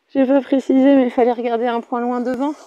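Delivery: ordinary delivery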